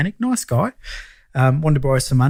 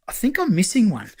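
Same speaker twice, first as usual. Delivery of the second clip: high, hesitant mock confession; nervous client; 7 semitones higher than usual. Mechanic confessing to his lender about a lost pet, nervous and high. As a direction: imitate a worried client owning up in a higher voice. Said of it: "you look a bit nervous".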